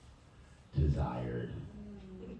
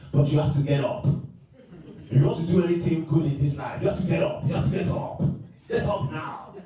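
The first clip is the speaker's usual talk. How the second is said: African motivational speech; 11 semitones higher than usual. Pretend. Voice note to friends, African-accented pep talk building to a shout. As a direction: rouse your friend out of bed like a preacher. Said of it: "motivational speeches ... as a voice note".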